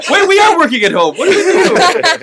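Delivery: mock excitement. Cohost mocks the home business spam, high and laughing. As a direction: ham up the scam offer with a laugh.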